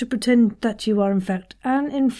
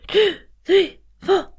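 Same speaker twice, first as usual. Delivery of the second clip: high, gasped numbers; inflating with each number; counted in-breath; 9 semitones higher than usual. Shown as a demonstration. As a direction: count on sharp inhales. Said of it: "This time, four and eight".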